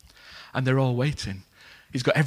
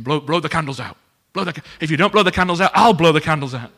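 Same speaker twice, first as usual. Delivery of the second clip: louder, fast, pressing her on; urging excitedly; 3 semitones higher, 11 dB louder than usual. Retold or imitated. Diner owner urging tearful Agnes to blow the candles, fast and loud. Harry urging, emotional, at cake.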